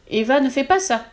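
threatening yell at Eva; terrible yell. A narrator's reading. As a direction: shout to stop her. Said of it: "hurle le double d'un air terrible".

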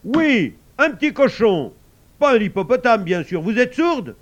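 loud, high exasperated outburst; losing patience. A narrator's reading. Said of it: "Papa Saïd perdit patience".

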